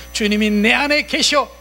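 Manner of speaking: high, forceful renunciation; emphatic denial. Shown as a demonstration. Believer answering the evil spirit, emphatic denial. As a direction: disown the spirit with total conviction. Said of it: "이렇게 부인하라고요".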